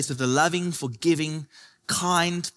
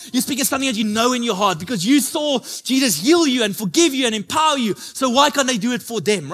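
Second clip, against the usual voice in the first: rising louder, higher preaching climax; full-force preaching; 6 semitones higher, 3 dB louder than usual. Acted. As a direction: build the sermon to a rousing peak.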